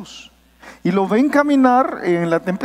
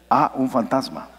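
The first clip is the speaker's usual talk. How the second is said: flat calm exclamation; 8 semitones lower than usual. Shown as a demonstration. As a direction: say "Ah, un fantasma" low and deadpan, no alarm at all. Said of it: "yo no diría".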